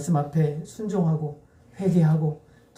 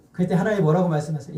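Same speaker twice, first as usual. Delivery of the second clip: usual speaking voice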